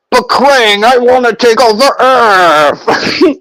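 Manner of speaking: high, slow put-on alien villain voice; Krang voice. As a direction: ham it up as the brain-blob villain Krang. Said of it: "I can't do the Shredder voice. But Krang!".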